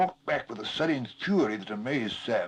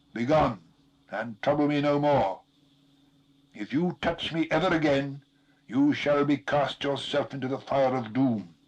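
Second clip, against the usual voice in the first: louder, lordly command from within fire; 4 semitones higher, 5 dB louder than usual. A narrator's reading. The vision commands Gollum to begone, loud and stern. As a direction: order it away with authority. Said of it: "a commanding voice".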